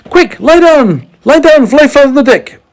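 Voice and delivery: high, loud urgent orders; boy shouting to the others to duck. A narrator's reading. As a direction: get them down fast. James urgent warning.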